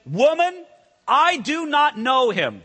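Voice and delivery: high, loud, emphatic denial; Peter's loud denial. Retold or imitated. Preacher voicing Peter denying loudly to the servant girl.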